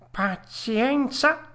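high, very slow creature voice; resigned rabbit. Acted. Rabbits losing their patient, slow high resignation. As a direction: stretch it out as a thwarted creature.

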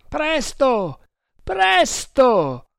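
high, frantic yelling; frantic cry. A narrator's reading. Man beside himself hurrying the rowers, frantic cry. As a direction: cry out beside yourself, pitch way up. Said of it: "gridava Tremal Naik, completamente fuori di sé".

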